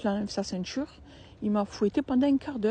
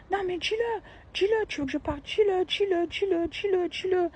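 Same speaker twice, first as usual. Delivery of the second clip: high, insistent repeated dis-le; nagging taunt; 10 semitones higher than usual. Retold or imitated. Sister-in-law nagging the narrator, high insistent taunt. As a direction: taunt her over and over, pitched up. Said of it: "elle fait".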